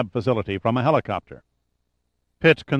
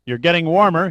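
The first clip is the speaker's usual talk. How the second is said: raspy voice, higher and louder; 7 semitones higher, 6 dB louder than usual. A narrator's reading. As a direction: rasp it through a speakerphone. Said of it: "Yeager's voice rasped over the speaker".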